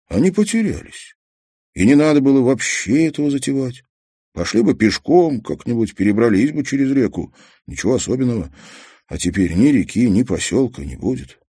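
high, fast voice of despair; panicking boy thinking aloud. Acted. Kazik's despairing thoughts voiced. (отчаяние мальчика)

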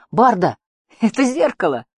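crying out. A narrator's reading. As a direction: shout a sudden alarmed discovery. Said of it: "крикнул он".

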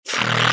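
long trilled "frrr"; voiceless rolling huff; animal snort. A narrator's reading. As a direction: snort back like a defiant little beast. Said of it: "откликнулся этот ненормальный".

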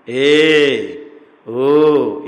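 हर स्वर "ए", "ओ" को बहुत देर तक खींचकर, ज़ोर से; drawn-out vowels. Shown as a demonstration. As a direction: stretch each vowel long and loud. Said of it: "इतने लंबा करने के बाद". (स्वरों को लंबा खींचकर बोलना)

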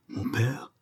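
softly, halting question; understated stammer of disbelief; stunned stammer. A narrator's reading. Prisoner seeing his father at the cell door, stunned. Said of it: "balbutia-t-il".

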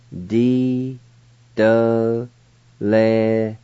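very slow "D, D, Leskin", long gaps between syllables. Shown as a demonstration. Teacher pronouncing rheumatism for learners, syllable by syllable. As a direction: draw out each piece of "D, D, Leskin" separately. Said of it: "now I'll pronounce these words".

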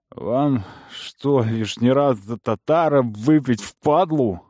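put-on slow, offended drunken protest. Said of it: "оскорбился енот".

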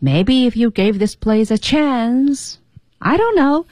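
chance 和 I don't know 连在一块儿说; linked phrasing. Shown as a demonstration. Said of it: "是连在一块儿说的". (连读不停顿)

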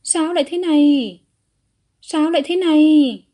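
loud, high cry of shock; flustered alarm. A narrator's reading. Father seeing his son vomit blood, flustered alarm. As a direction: cry out in panicked disbelief. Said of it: "Vu Các Lão luống cuống".

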